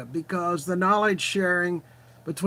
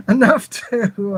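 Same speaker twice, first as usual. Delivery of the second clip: laughing; 4 dB louder, slower than usual. Real, not acted. Laughing at own joke.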